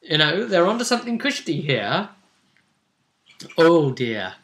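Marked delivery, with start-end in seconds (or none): none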